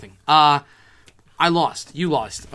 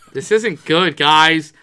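louder call to the group; loud worried call; 9 dB louder, much slower than usual. Retold or imitated. Fan telling friends in the stands, loud worry. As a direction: call it out to the guys.

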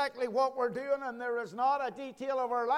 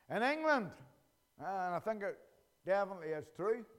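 usual speaking voice

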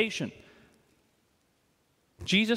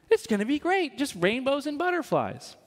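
higher, sing-song false optimism; mock cheerfulness; 6 semitones higher than usual. Preacher mocking the idea that faith is easy, falsely cheerful. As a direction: sound naively delighted, tongue in cheek.

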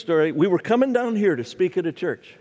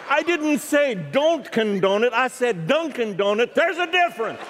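exasperated, laughing retort; 7 semitones higher than usual. Retold or imitated.

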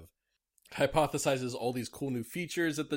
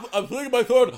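high goofy dog voice; squeaky, silly sword dog voice; Zacian impression; 12 semitones higher, 12 dB louder, faster than usual. Still voicing the sword dog.

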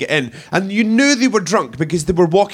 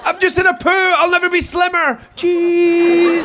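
high, louder mock girly gush; mock girly selfie voice; 11 semitones higher, 3 dB louder than usual. Female friend posing for toilet photos, high mock girly gush. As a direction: gush like a tipsy girl posing. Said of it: "standing in bogs going".

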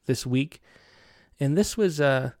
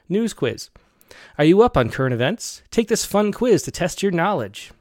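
quiz host voice; 5 semitones higher, 5 dB louder than usual. Acted. Poem opens as quiz intro.

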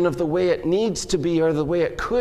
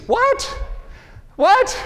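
very high squeaky what; incredulous. Acted. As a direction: squeal it in disbelief. Mock disbelief at carol lyric.

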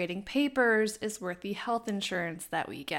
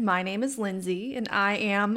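normal talking voice

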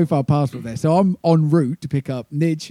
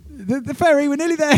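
much higher, louder repeat to be heard; 6 dB louder than usual. Retold or imitated. Repeating to ferry lady on bad line, higher and louder. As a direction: shout it again down the phone. Repeating as she can't hear.

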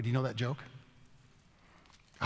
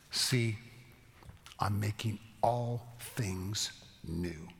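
very slow, softer, hushed promise; slow solemn scripture; 4 semitones lower, 4 dB quieter, much slower than usual. Acted. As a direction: let each word land slowly.

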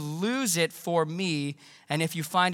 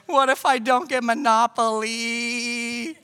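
put-on high, slow, tearful kid voice; whiny little boy; 5 semitones higher, 4 dB louder, slower than usual. Son crying by the tree, small and whining. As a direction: plead like a worried eight-year-old. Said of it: "He's like".

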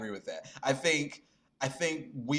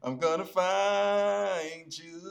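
playful sing-song; 3 semitones higher, 4 dB louder than usual. Pretend. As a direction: tease it like hide and seek. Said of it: "playful hide-and-seek intro".

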